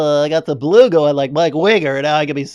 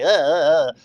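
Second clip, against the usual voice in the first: nerdy voice. Retold or imitated. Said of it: "he talks like this".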